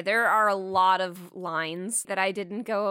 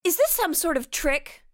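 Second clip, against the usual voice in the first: put-on higher-pitched quoted girlfriend; mock suspicion; 7 semitones higher than usual. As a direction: lightly act out an accusing ex. Acted suspicious ex-girlfriend from film.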